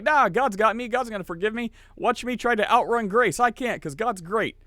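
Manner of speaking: high-pitched, breezy overconfidence; mock carefree sinner. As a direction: parody someone gleefully sure of getting away with it. Acts a carefree presumptuous sinner.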